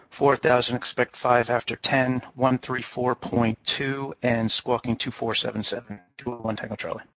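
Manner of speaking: normal talking voice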